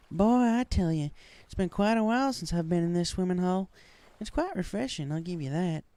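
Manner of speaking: cheerful Southern pony teasing her friend; Applejack voice. A narrator's reading. Friend at swimming hole, high cheerful Southern pony voice.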